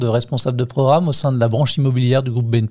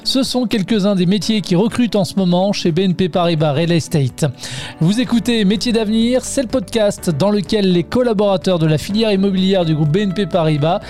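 higher-pitched, steady presenter read; podcast intro read; 5 semitones higher than usual. Acted. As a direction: announce the show like a radio host, pitched up. (voix de présentation de podcast)

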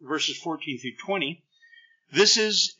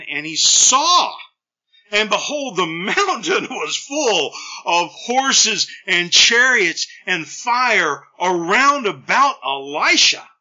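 slow, loud, rising with wonder; awed proclamation; 3 semitones higher, 7 dB louder, slower than usual. Acted. Preacher reading the vision of fire, slow and booming. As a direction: unveil the fiery host slowly and grandly.

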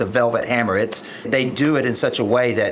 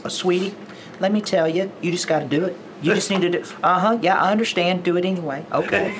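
sweet Oklahoma voice pitched higher, coaxing; sugar-coated Oklahoma pep talk, higher up; Mo Anderson impression; 7 semitones higher, faster than usual. Agent imitating the Velvet Hammer coaxing everyone, higher Oklahoma sweetness. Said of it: "she just talks like this".

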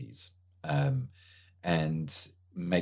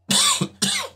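sharp single cough; a dry cough that clears the throat; 4 dB louder than usual. Real, not acted. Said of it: "coughing here".

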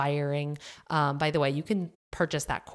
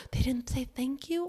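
put-on high, soft, scandalized old-lady question; mock an old fuddy-duddy who can't believe it; appalled old lady; 9 semitones higher, 5 dB quieter than usual. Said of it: "like an old fuddy-duddy".

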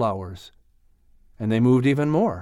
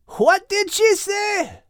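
Scottish shout; slower than usual. Acted. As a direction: bellow it, half scolding half proud. Max half-deaf asking.

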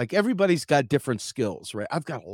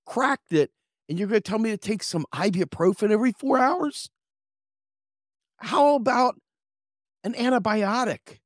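much higher and louder indignant rant; outraged rant; 9 semitones higher, 4 dB louder than usual. Retold or imitated. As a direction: let the outrage climb and crack. Yelling at clinic over ibuprofen.

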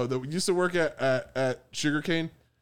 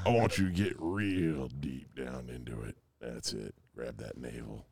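much lower and softer, intimate; creepy murmur; 8 dB quieter than usual. Pretend. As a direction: coax in a low creepy hush. Creepy instructions as a bit.

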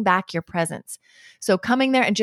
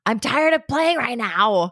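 drawn-out, higher-pitched mock complaint; exasperated judgment; 5 semitones higher, slower than usual. Fed-up parent to child during play, exasperated. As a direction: whine it slowly, fed up. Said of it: "comes out more like a judgment".

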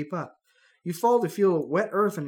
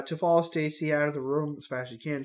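ordinary talk, nothing marked

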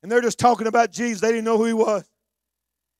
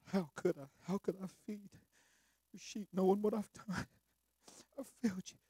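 ashamed Peter; 18 dB quieter than usual. Acted. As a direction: admit failure quietly. Peter confesses failure.

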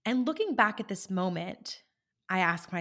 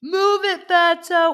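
much higher and louder taunting yell; mock yell; 10 semitones higher, 13 dB louder, slower than usual. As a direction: shout it from a passing car. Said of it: "they yell".

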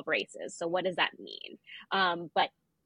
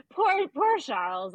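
mock pity; 8 semitones higher, 4 dB louder, slower than usual.

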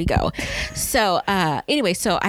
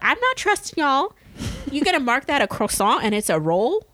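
sassy; 7 semitones higher than usual. Retold or imitated. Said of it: "No, I was like".

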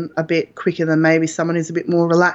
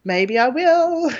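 higher voice, coaxing herself to hold back; a wry inner voice, pitched well up, with a slow teasing last bit; self pep talk; 9 semitones higher, slower than usual. Retold or imitated. Mother in labour telling herself not to push, higher coaxing inner voice. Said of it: "and I was like".

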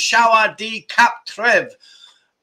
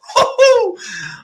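a burst of high laughter; 5 dB louder than usual. Real, not acted. Laughing at barbecue plan.

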